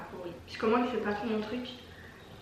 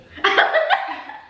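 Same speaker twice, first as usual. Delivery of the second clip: rire aigu soufflé; éclat de rire aigu; 7 dB louder than usual. Real, not acted. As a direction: laisse échapper un rire aigu. Rire après avoir trop bu. (éclat de rire aigu)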